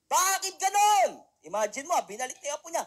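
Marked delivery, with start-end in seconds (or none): 0.1-1.2 high, slow, indignant demand; angry complaint. Acted. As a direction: protest to God. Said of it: "Galit na galit".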